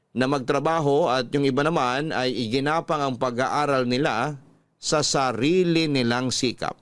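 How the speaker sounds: normal talking voice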